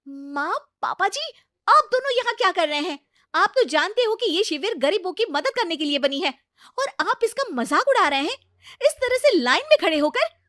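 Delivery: loud, high, indignant scolding; shouting at her in-laws out of wounded pride; angry outburst. Acted. Priti shouting at her in-laws in front of everyone, loud and indignant.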